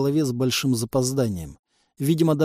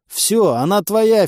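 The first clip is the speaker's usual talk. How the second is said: громко; 7 semitones higher, 6 dB louder, slower than usual. Acted. Ваня передаёт пленницу.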